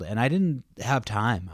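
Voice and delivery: ordinary talk, nothing marked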